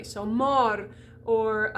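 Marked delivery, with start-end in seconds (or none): 0.2-0.9 mor drawn out with open "aw". Shown as a demonstration. Teacher showing O before two consonants, open vowel. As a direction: say "mor" with the O opened up. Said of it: "open that up like a gateway".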